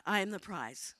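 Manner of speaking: normal talking voice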